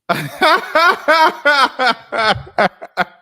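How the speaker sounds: high, loud burst of laughter; long cackling laugh in a high pitch. Real, not acted. Laughing on remembering the fake British accent, loud high laugh.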